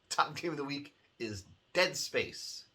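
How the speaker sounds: ordinary delivery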